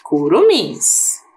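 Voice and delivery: curumins stretched slowly and clearly; slow careful word. Shown as a demonstration. Teacher presenting a word to pupils, slow and clear. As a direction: draw out curumins for the class. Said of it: "E a terceira".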